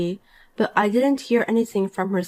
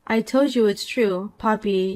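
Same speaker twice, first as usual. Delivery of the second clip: ordinary talk, nothing marked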